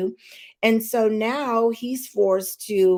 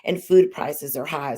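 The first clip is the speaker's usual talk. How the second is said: ordinary talk, nothing marked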